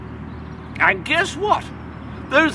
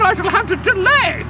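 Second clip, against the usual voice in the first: ordinary delivery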